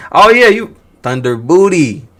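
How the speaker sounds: loud, slow mocking nickname; playful mock rename; teasing. Real, not acted. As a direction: drag out the joke title. Teasing the title.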